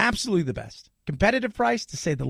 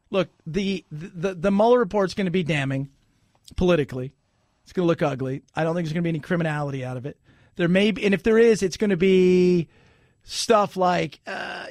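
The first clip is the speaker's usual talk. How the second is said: ordinary talk, nothing marked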